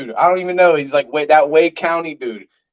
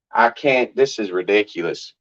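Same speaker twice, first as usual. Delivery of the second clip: ordinary delivery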